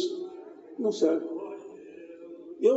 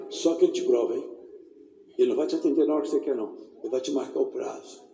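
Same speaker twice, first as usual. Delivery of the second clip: lower, softer intimate sermon voice; quiet intimate pulpit talk; soft low preaching; 8 semitones lower, 4 dB quieter than usual. Acted. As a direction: bring it down close and gentle.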